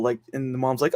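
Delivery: ordinary talk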